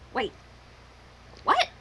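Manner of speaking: ordinary talk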